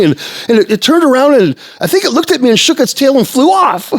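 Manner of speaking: very high, loud, breathless account; imitated overexcited eyewitness; excited friend. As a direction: gush it out in a high, racing voice. Friend excitedly tells what he saw.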